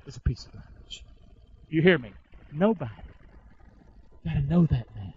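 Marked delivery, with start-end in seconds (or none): 0.1-1.0 whisper. Real, not acted. Preacher whispers after loud Nobody.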